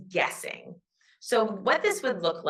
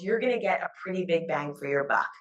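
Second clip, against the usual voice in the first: usual speaking voice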